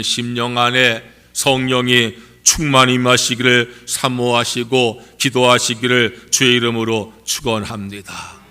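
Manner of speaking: ordinary delivery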